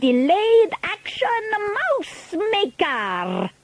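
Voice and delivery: very high, slow, drawn-out proclamation; Grand High Witch. A narrator's reading. Witch leader unveiling her formula, high slow villain voice. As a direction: unveil it grandly, stretching every word.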